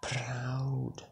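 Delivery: mock whisper of the Irish guy; whispered word. Imitating the Irish guy answering, a mock whisper. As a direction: breathe the word out like a secret. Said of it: "and he's like".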